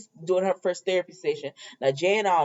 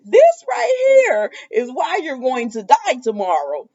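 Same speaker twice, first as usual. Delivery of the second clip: higher, louder mock-ominous pronouncement; laugh-tinged, raised-voice retold threat; mock dramatic threat; 6 semitones higher, 6 dB louder than usual. Recapper acting out a soap threat, mock dramatic.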